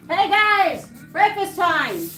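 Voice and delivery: high loud call across the house. Real, not acted. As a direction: call the cats to eat. Calling cats to breakfast.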